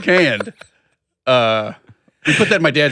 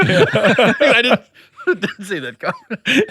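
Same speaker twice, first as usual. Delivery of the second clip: slow words through laughter; laughing; 7 semitones higher, much slower than usual. Real, not acted. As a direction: laugh it out slowly. Laughing hard.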